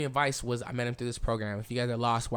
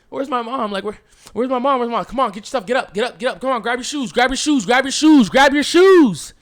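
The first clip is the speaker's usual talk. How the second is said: high, loud, rapid-fire urging that builds to a full shout; frantic shouting; 9 semitones higher, 11 dB louder than usual. Retold or imitated. Storyteller acts out being rushed out of the house, frantic shouting. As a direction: yell them out of bed, louder each time.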